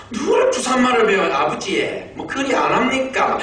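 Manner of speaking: imitating a daughter's high Busan accent; Gyeongsang dialect imitation. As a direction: mimic Busan dialect with jumping high pitch. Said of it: "이런 경상도 말". (딸의 경상도 사투리를 높게 흉내 내며)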